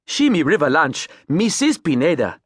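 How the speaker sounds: Creole captain naming the boat; Creole accent. Acted. Captain naming his boat, Creole accent. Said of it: "his Creole accent".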